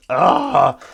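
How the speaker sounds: short, loud, high yell; sudden loud cry of alarm; mock scream. Sudden cry after nuclear threat.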